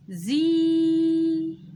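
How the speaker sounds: زي sung out long, high and loud; long vowel. Shown as a demonstration. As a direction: hold the ee of زي for two beats. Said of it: "يبقى حركتين".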